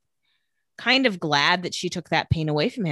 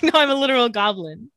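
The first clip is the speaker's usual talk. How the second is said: higher, louder mock insistence; silly goblin voice; 5 semitones higher, 4 dB louder than usual. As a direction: play it as a goofy goblin insisting. Pretend goblin insisting to boyfriend.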